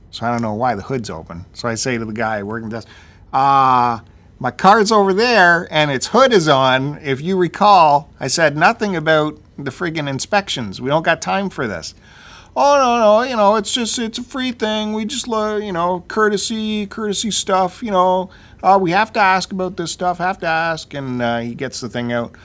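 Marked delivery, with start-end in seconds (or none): 3.3-11.9 louder, higher voice laying down objections; irked customer complaint. Acted. Annoyed customer confronts desk worker. 12.5-20.9 much higher, hesitant excuse-making voice; placating service clerk. Acted. Desk worker's excuses, voiced by teller.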